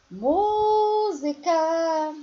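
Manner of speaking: "Música" sung high, MÚ held very long; prolonged stressed syllable. Shown as a demonstration. Teacher repeating Música to prove the lengthening, sing-song stretch. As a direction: draw MÚ right out in "Música". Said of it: "faz um prolongamento".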